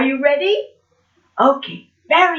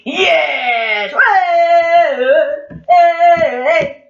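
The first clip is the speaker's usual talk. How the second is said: shouted, drawn-out cheer; loud whooping "hey"; loud puppet monkey; 8 dB louder, much slower than usual. Acted. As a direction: holler to show off being loud. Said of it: "can you be loud?".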